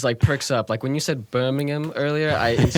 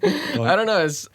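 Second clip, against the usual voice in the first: usual speaking voice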